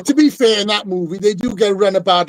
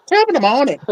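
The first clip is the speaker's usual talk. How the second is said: put-on higher, hammier Irish accent; 5 semitones higher than usual. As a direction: push the Irish brogue up a notch. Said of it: "my Irish accent's not very good".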